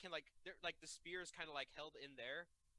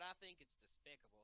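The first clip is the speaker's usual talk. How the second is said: put-on southern accent; 8 dB quieter than usual. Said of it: "everyone have a southern accent".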